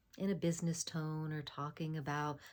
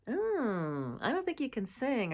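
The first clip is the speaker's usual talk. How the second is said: doubting choir teacher; 7 semitones higher, 3 dB louder than usual. Retold or imitated. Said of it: "a choir teacher or when a parent said".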